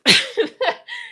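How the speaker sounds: a high, breathy burst of laughter; high-pitched laugh. Real, not acted. Embarrassed at being out of breath.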